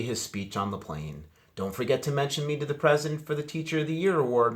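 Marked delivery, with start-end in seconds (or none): 1.6-4.5 high, fast, loud fussy teacher; teacher's reminder. A narrator's reading. Teacher pushing student to mention him to president, fast. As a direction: rattle off a pushy reminder. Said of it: "Mister Ratburn reminded him".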